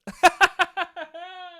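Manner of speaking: high burst of laughter; rapid, high-pitched giggling; real laugh. Real, not acted. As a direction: laugh helplessly at someone's blunder. Laughing at catfish's blunder.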